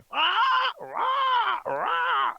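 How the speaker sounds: very high, loud crow squawk; harsh repeated cawing. Acted. Crow flying in through the window, cawing. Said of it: "crow flies in through the window".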